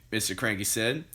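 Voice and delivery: usual speaking voice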